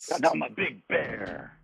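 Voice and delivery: slow, dragged-out bear voice; slow, heavy talking bear delivering the pun; bear's punchline. Acted. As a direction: drawl the punchline like a dim bear. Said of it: "Bear looks at the bartender and goes".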